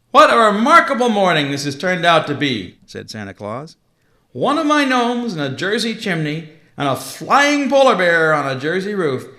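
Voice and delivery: booming Father Christmas, amused and surprised; jolly Santa Claus. Acted.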